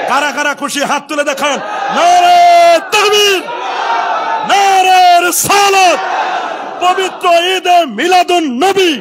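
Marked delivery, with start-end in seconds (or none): 1.5-9.0 loud, high, drawn-out rallying call; full-voiced slogans, stretched out slowly; slogan shouting. Real, not acted. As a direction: lead the crowd in a chant. Leads the crowd in slogans.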